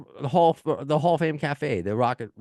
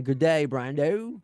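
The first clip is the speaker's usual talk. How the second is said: bad Australian accent; 6 semitones higher, slower than usual. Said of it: "I won't read the rest of the email like that".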